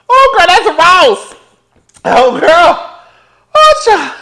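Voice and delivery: loud, high startled yelping; startled outcry. Real, not acted. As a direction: cry out in shock, drawing the words out. Startled by noise, cries out.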